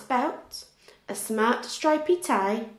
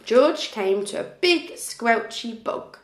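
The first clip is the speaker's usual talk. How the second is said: ordinary delivery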